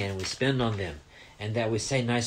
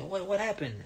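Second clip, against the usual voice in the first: a touch higher, asking anxiously; worried mom; 7 semitones higher, 5 dB quieter than usual. Retold or imitated. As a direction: voice the mother's mild alarm. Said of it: "she said".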